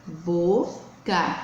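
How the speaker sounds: "boca" split in two, bo... ca; syllable by syllable. Shown as a demonstration. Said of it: "formada por duas sílabas". ("boca" sílaba por sílaba)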